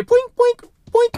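high-pitched springy boink; quick squeaky shot sound effect; high boink noise. Pretend. Player voicing shots fired in the game, high boink.

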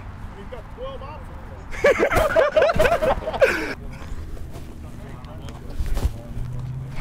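big, high-pitched laugh; long high laugh. Real, not acted. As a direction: burst out laughing. Big laugh after joke.

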